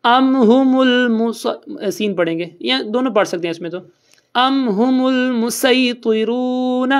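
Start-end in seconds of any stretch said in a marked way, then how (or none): 0.0-1.9 ام ہم المس drawn out slowly; Quran recitation. Shown as a demonstration. Recites a verse. 4.3-6.9 slow recited اَأَعْجَمِيٌّ وَعَرَبِيٌّ; slow tajweed recitation; Quran recitation. Shown as a demonstration. Recites a verse.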